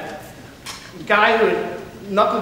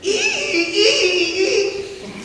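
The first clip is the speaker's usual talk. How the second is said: high, squealing ee-ee cackle; a rusty-nail laugh, pitched way up; Teddy's trademark squealing cackle; shrill cackling laugh; 3 dB louder, much slower than usual. Acted. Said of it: "his patented Teddy Duchamp laugh".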